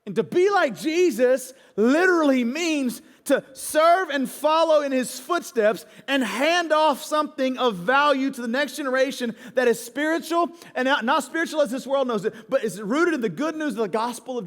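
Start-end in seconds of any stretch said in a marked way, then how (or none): none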